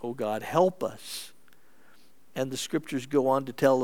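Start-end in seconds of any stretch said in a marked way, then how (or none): 0.0-1.3 low, soft, slowing plea; hushed plea to God; simple prayer. Acted. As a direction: pray it quietly and humbly. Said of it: "he just prays".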